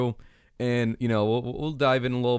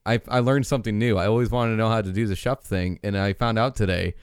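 normal talking voice

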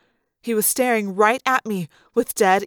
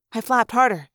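plain reading voice

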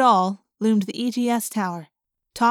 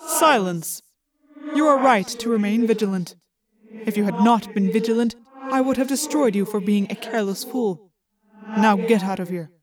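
imperious alien commander with measured menace; great and terrible Visser. A narrator's reading. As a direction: dismiss an underling with cold authority. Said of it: "acting the role of the great and terrible Visser".

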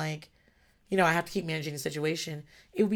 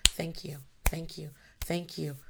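calm thanks; 5 dB quieter, slower than usual. Acted. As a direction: offer quiet, unhurried thanks. Contrasting thanks with nagging.

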